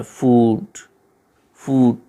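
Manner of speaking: फूड said with a long drawn "oo"; फूड with a long ऊ sound; long oo demo. Shown as a demonstration. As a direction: stretch the vowel in फूड long. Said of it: "फूड में जो है बड़े ऊ की मात्रा है".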